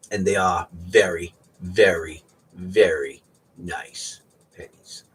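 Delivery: each very leaned on, slowing down at the end; drawn-out, emphatic praise of the pennies. Real, not acted. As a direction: stretch out the very very very nice pennies. Piles up very, very, very.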